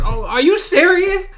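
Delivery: high, loud "are you serious"; can't believe what he's seeing; disbelief. Real, not acted. Viewer yelling at the screen in disbelief.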